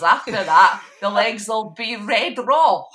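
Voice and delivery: loud, slowing, breaking into laughter; laughing. Real, not acted. Host jokes about sunburnt Scottish legs, laughing loudly. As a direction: laugh through the punchline.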